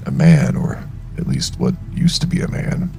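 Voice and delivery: plain reading voice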